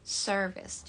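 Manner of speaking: American "service" with the r sounded; American accent. Shown as a demonstration. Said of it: "Jadi kalau dalam American". (logat American)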